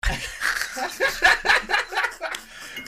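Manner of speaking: loud, high burst of laughter; shrieking laugh. Real, not acted. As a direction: cackle out loud.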